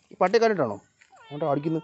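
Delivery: ordinary talk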